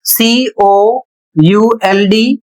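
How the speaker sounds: very slow letter-by-letter spelling; spelling it out for students; spelled out. Shown as a demonstration. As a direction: spell सीओयूएलडी very slowly, one letter at a time. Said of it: "अगला शब्द है".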